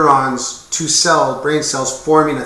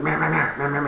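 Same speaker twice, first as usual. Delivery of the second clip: mock yapping dog; 2 semitones higher, 5 dB quieter, faster than usual. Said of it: "little dog that's pining and yapping, going".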